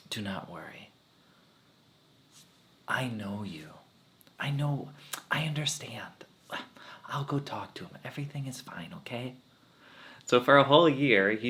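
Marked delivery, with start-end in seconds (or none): 0.1-9.3 soft, half-whispered reassurance; hushed consoling. Retold or imitated. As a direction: soothe her quietly, almost a whisper. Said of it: "And he would go".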